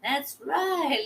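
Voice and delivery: usual speaking voice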